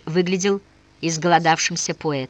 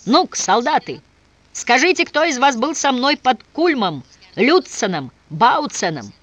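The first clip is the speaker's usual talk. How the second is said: loud, high rallying call; roaring rallying speech; 6 semitones higher, 4 dB louder than usual. Acted. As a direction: rouse the troops with a loud call. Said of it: "обратился к солдатам". (громкий призыв к солдатам)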